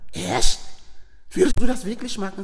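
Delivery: loud, amazed retold question; wide-eyed astonishment. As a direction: re-enact someone who can hardly believe the offer. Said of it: "desto größer wurde Janas Augen". (erstauntes, lautes Nachfragen)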